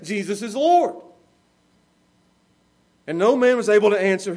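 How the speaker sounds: loud, declaiming Bible reading; forceful scripture reading. Acted. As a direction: proclaim the verse to the hearers.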